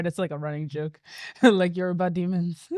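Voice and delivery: usual speaking voice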